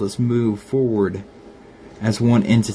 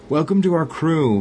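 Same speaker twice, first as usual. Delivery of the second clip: narrated call with raised pitch; mild, higher-pitched call of welcome; 6 semitones higher than usual. A narrator's reading. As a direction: call it out higher without really shouting. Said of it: "called out".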